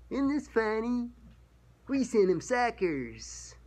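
high, smug schoolyard taunt; mocking middle schooler. Imitating Christians taunting a mocker, high smug middle-school tone. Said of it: "on the level of a middle schooler".

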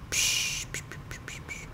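soft, unvoiced shushes fading out; whispered shushes, fading away; shushing hiss. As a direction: hush yourself while sneaking. Sneaking past enemies.

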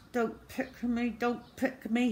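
put-on high, hushed child's pleading; silent begging. A narrator's reading. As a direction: beg under pressure, small and high. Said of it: "he begged silently".

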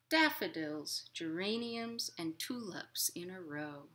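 gentle, lilting picture-book reading; reading to children. A narrator's reading. Librarian reading a picture book to children, lilting. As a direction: read it like storytime for little kids.

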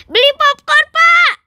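high, loud call to the popcorn seller; child calling loudly to buy popcorn. Acted. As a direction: shout the order across the stall.

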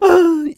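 high, quick gasp; surprised gasp. Acted. Villager gasps as another buys a house, surprised. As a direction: catch your breath in surprise.

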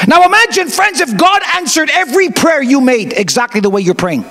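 high, ringing appeal to the crowd; preaching call-out. Acted. Preacher challenging friends to imagine answered prayers, ringing. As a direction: call the congregation in.

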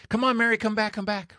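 high, urgent calls to come back; rescuers yelling. Retold or imitated. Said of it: "And they're yelling at her".

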